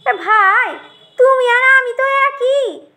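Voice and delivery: high, drawn-out friendly appeal; chummy brinjal. Acted. Brinjal tells tomato they are alike, chummy.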